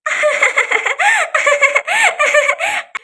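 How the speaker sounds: loud, high-pitched gleeful cackle; gleeful high laugh. Acted. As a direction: cackle with delight at the prank. Sonam gleeful about revenge plan. (ज़ोर से ऊँची खुशी भरी हँसी)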